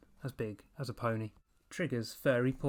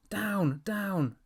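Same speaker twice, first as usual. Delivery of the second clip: much higher, louder shrieked command; 8 semitones higher, 5 dB louder than usual. A narrator's reading. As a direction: shriek at the leaping dog. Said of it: "Evan shrieked".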